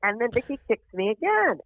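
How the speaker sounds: ordinary talk, nothing marked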